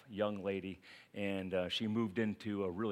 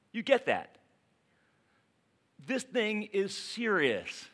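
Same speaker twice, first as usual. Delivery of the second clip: high, loud preaching at full force; fiery preaching; 7 semitones higher, 4 dB louder than usual. Acted. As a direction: drive each warning home from the pulpit. Preaching to the congregation.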